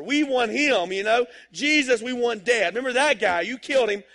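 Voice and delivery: loud, high, relentless preaching; preaching at full force. Acted. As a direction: hammer the crowd with every line. Peter preaches to the crowd.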